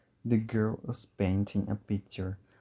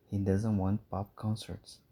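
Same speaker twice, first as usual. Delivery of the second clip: ordinary delivery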